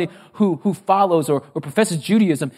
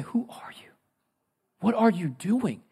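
hushed questioning of God; soft, stunned questions to God; bewildered whisper; 3 semitones higher, 8 dB quieter than usual. Acted. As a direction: start in a whisper, then barely voice it. Bewildered believer questioning God in suffering.

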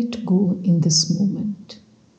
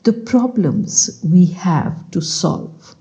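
measured, louder story-reading voice; bedtime reading; 5 dB louder than usual. Acted. Meditation guide reads a book extract to sleepy listeners, measured reading. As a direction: read the extract aloud in unhurried phrases.

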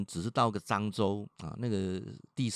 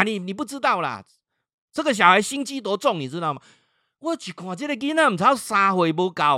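loud, high-pitched acted complaint; mother's indignant protest; 9 semitones higher, 9 dB louder than usual. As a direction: insist hotly as the exasperated mother. Mother arguing back, retold. (模仿妈妈激动地辩解)

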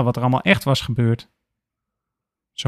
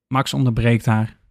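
plain reading voice

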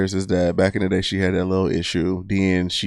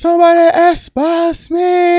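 very high, slow, sing-song NPC chant; NPC streamer voice; 5 dB louder than usual. Retold or imitated. Friend imitating the NPC streamer, squeaky and slow. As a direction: drag it out like a TikTok NPC.

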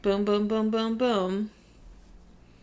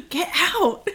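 surprised gasp; 8 semitones higher, 4 dB louder than usual. Real, not acted. Opening a gift.

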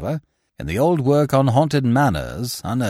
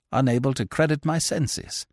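ordinary delivery, nothing marked

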